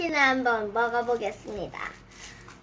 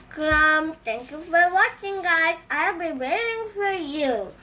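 slow drawn sign-off; 2 semitones higher, much slower than usual. Acted.

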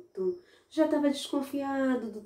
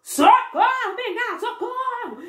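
high loud cry for help; boy shouting to the cane as the innkeeper comes; 6 dB louder than usual. Acted. Um menino deu um grito.

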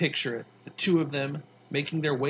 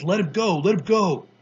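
mock mad mom; 4 semitones higher, 8 dB louder than usual. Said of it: "she gets all mad at me".

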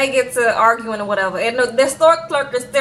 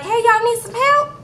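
store clerk; 9 semitones higher than usual. Retold or imitated. Store clerk greets shoppers.

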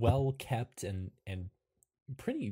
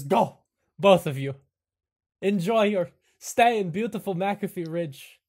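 loud, commanding host voice; hammed-up proclamation in character; grand send-off; 7 semitones higher, 10 dB louder than usual. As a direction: send them away with a flourish. Host character sends the guests off.

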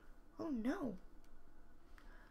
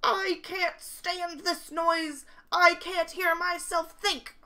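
higher, louder, slow and fed up; loud exasperated complaint; 8 semitones higher, 7 dB louder than usual. Acted. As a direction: at wit's end with the noise. Winky complains about noise.

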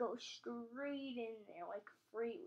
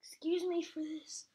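ordinary talk

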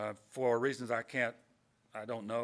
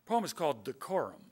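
normal talking voice

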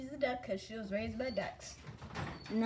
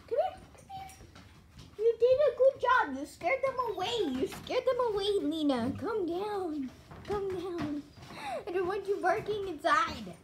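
sing-song praise for the dog; high excited pet talk; 10 semitones higher, 5 dB louder than usual. Real, not acted.

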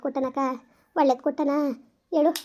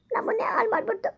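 higher, softer, sobbing through ಹಾಳು; crying; 7 semitones higher, 5 dB quieter than usual. Acted. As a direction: break into tears on the repeat. Confessing to aunt, breaking down. (ಅಳುತ್ತಾ ಎತ್ತರದ ಮೆಲು ಧ್ವನಿಯಲ್ಲಿ)

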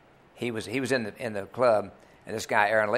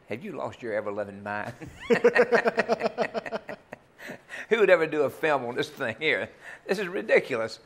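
incredulous; 6 semitones higher than usual. Retold or imitated. Incredulous retort about film.

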